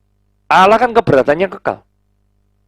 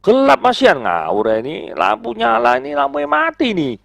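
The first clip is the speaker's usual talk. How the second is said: exasperated complaint; 6 semitones higher than usual. Acted. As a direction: voice climbing high in mock protest. Acted complaint that it is dark.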